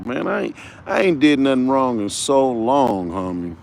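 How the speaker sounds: normal talking voice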